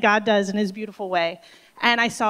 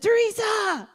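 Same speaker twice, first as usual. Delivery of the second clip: excited call; 11 semitones higher, slower than usual. Retold or imitated. Phil bursting in calling out to Teresa, excited high call. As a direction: call across the room with big news. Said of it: "Just like that".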